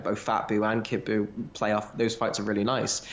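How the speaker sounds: usual speaking voice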